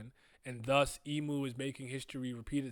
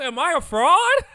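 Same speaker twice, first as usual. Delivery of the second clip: mock panicked yell; 13 semitones higher, 18 dB louder than usual. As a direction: shout it, much higher and louder. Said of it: "and I'm like".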